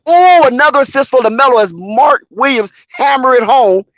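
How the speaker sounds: high, loud excited game call; live play-by-play. Real, not acted. Streamer calling the Hornets game live, excited. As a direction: call every pass as it happens.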